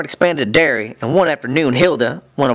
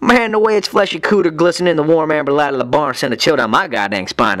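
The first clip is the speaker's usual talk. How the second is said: usual speaking voice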